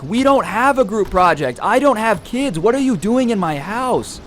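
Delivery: high-pitched mock-angry protest; imitated yelling, pitch pushed way up; outraged homeowner. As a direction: act out a stranger indignantly confronting an intruder. Acted homeowner screaming at intruder.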